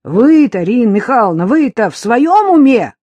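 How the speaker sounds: высоко и очень громко, наседая; крикни, не веря своим ушам; громкое возмущение. A narrator's reading. Любка возмущена словами соседки. (возмущённый вскрик простой бабы)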